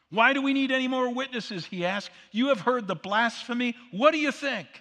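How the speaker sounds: an indignant high priest; outraged priest. Acted. Preacher voicing the high priest, outraged and loud.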